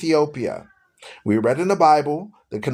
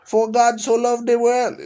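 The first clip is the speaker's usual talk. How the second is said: higher, mock preachy quoting voice; mocking a preacher dude; 6 semitones higher than usual. Mocking the Filipino dude who argues him down, higher and preachy. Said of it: "I'll get a Filipino dude come and argue me down".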